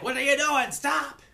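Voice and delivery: high, loud mock yell; alarmed scolding. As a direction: shout to make her stop. Cosmic Owl yells at her.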